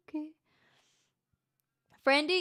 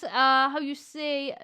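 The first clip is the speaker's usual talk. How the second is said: ordinary delivery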